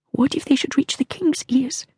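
high, soft worried question; queen fretting about the young king; fearful queen. A narrator's reading. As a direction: let fear creep in. Queen fears.

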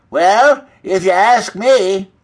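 high, loud, drawn-out hen voice; slow, emphatic hen character; opinionated hen. A narrator's reading. Opinionated hen starts to speak.

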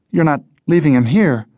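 high-pitched, urgent objection; alarmed protest. Acted. Jess blocks hatch and protests.

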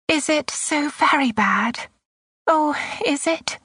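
high, anxious woman's voice; anxious plea. A narrator's reading. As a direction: plead for an answer, pitched well up. Anxious mother questioning young officer.

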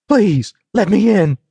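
high, desperate plea to be let in; urgent pleading. A narrator's reading. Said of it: "Diamond pleaded".

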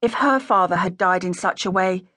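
usual speaking voice

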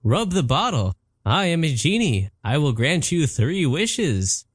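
a showy genie announcing himself. Acted. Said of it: "The voice told me".

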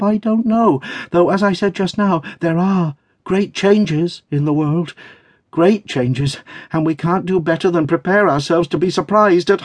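high, nervous chatter; flustered chattering. A narrator's reading. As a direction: talk on to hide a pounding heart. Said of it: "talking on as fast as she could to hide its palpitating state".

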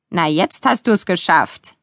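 slow, drawn-out teasing cheer; cheerful. A narrator's reading. Demon dog gloating that the uncle is furious, mildly cheerful. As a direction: gleefully take your time over it. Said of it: "sagte Jim fröhlich".

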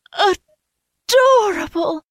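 slow, high delighted sigh. A narrator's reading. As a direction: melt with love. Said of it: "Soren's mother sighed".

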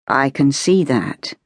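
slow, measured gentleman's reply; unhurried and deliberate; slowly. Acted. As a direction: draw the words out thoughtfully. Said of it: "he said slowly".

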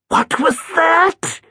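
high, alarmed ghostly hiss; startled ghost Edith. Acted. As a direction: catch a sound and react in alarm as the old ghost. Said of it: "she hissed".

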